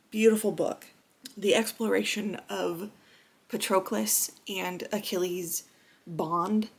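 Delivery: normal talking voice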